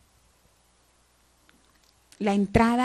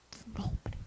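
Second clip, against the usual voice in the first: whisper; 18 dB quieter than usual. Acted. Quoting the prayer.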